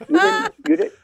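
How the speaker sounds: a real catch in the throat, half laugh half sob; choked up. Real, not acted. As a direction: voice cracking between laugh and tears. Chokes up quoting late grandfather.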